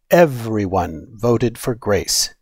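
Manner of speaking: ordinary delivery, nothing marked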